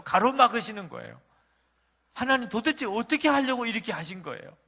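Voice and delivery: rising to a high, loud cry of protest; 이 셋째 어떻게 기르라고 pleading, then 하나님 flung out loud; anguished complaint. Retold or imitated. Pastor crying out to God over his newborn, loud anguished protest.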